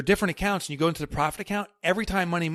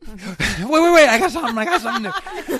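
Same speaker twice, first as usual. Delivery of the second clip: excited; 10 semitones higher, 11 dB louder than usual. Real, not acted. Excited interruption with laughter.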